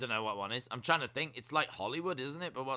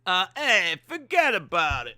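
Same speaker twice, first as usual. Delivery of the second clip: put-on New York accent; 7 semitones higher, 10 dB louder than usual.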